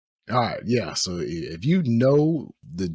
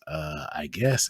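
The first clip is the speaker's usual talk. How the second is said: mumbled reply; 8 semitones lower, 5 dB quieter, slower than usual. Retold or imitated. Voicing the guys' reply.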